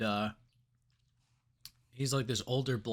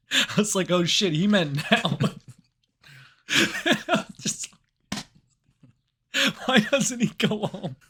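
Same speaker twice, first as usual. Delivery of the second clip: high, loud and laughing through the words; real laughter breaking into the story; laughing disbelief; 9 semitones higher, 6 dB louder than usual. Real, not acted. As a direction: crack up while telling it.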